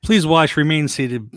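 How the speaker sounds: announcer-style ride safety spiel; theme park ride spiel. Shown as a demonstration. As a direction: boom it out like a ride recording. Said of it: "I was gonna do the old s- ride spiel".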